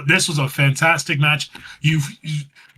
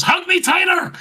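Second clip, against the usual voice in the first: much higher, hammed-up plea; squeaky mock plea. Podcaster mimicking wrestler begging for a hug, squeaky. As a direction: squeal it like a needy friend. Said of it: "And she goes".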